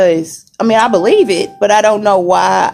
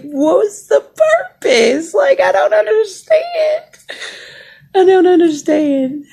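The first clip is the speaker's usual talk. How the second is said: laughing disbelief; 10 semitones higher than usual. Real, not acted. Patient showing followers her window view, laughing high disbelief. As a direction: squeal at how absurd it is through laughter.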